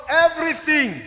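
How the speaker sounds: loud, high congregational prompt; emphatic call-out. Real, not acted. Preacher prompting those at home to say everything, loud emphatic call. Calls word for congregation to repeat.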